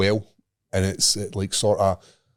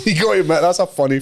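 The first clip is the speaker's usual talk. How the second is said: laughing; 11 semitones higher, faster than usual. Real, not acted. Teller cracking up at own story.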